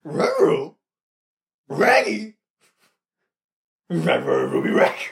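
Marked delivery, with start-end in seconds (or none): 0.1-2.4 Scooby-Doo voice. Retold or imitated. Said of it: "Without the scream".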